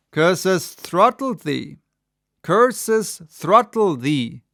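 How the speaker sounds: loud, slow furious curse; angry yell. A narrator's reading. Ahab cursing Gabriel, loud and slow. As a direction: spit the curse out. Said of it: "yelled Ahab".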